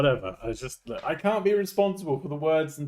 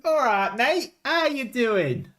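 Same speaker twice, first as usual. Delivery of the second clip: high, drawn-out matey greeting; hammed-up British lad saying hello; British lad character; 8 semitones higher, slower than usual.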